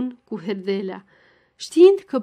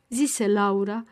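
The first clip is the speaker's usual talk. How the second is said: usual speaking voice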